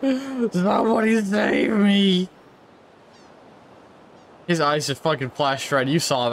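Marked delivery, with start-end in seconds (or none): 0.0-2.3 high, drawn-out hammy villain voice; put-on villain boss. Player as boss addressing minions, hammed-up villainy. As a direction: ham it up high and slow. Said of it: "I'm up here like".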